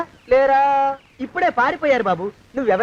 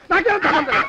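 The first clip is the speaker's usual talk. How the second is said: higher, frantic protest rising at the end; panicked denial; 4 semitones higher than usual. Acted. Disowning the house as police loom, panicked denial. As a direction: wail the denial in alarm.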